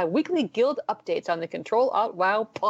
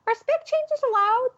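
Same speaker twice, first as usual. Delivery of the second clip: very high, slow silly voice; slower than usual. Retold or imitated. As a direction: squeak it in a goofy voice. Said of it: "my little rambly bumper".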